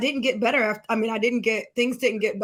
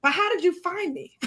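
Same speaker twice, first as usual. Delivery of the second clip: high, bewildered question; retold bafflement, pitched up; confused; 7 semitones higher than usual. Asking how the friend found her, confused. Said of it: "so confused".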